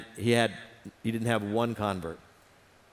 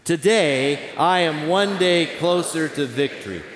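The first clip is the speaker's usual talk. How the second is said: loud, rousing declaration; with enthusiasm; 4 semitones higher, 6 dB louder, slower than usual. Shown as a demonstration. Pastor leading the standing congregation, loud and enthusiastic. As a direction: proclaim it like a victory chant, full of fire. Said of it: "let's say it with enthusiasm".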